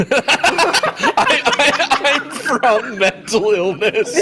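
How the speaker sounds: high, loud, helpless laughter in the words; laughing. Real, not acted. Game master echoes the player's joke, laughing hard. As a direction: squeal it out through laughter.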